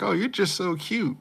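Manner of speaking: put-on high cooing girl voice; hammed-up cutesy compliment; mock cooing friend. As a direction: coo over a friend.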